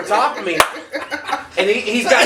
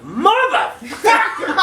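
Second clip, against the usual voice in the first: high, drawn-out mock outrage; retold exasperated yell; 14 semitones higher, much slower than usual. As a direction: stretch the curse out in exasperation. Retells reacting to shaking bed.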